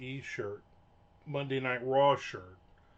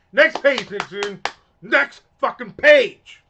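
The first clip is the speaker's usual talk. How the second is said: loud, higher, slow exasperated yell at the page; frustrated shout; 9 semitones higher, 19 dB louder, slower than usual. Real, not acted. Yelling at the screen to get the next page. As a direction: bellow it out in real annoyance.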